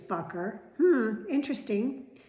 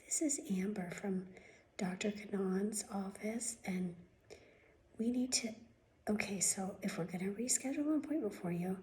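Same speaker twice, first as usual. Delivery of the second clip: mocking receptionist whisper; 8 dB quieter than usual. Mocking the doctor's office receptionist, soft whispered voice. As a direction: mimic her limp, whispery phone voice. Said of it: "this is how they talk".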